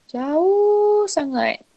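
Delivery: "jauh" stretched very long and high; drawn-out emphasis. Real, not acted. Teacher showing image distance from surface, drawn-out emphasis on "jauh".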